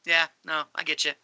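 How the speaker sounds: understated, accepting reply; matter-of-fact. Retold or imitated. Character accepting his fate, matter-of-factly. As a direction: say it as simple fact, no malice. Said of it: "It was all just, like, matter-of-factly".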